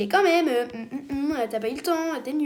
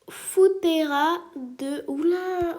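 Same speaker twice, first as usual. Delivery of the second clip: Italian name attempt; 5 semitones higher, 4 dB louder, much slower than usual. Shown as a demonstration. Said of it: "j'ai pas l'accent italien".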